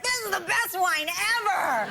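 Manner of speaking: high, slow, hammed-up praise; mock rave. After seeing the bill, slow mock raving about wine. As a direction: gush over the wine ironically. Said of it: "We're like".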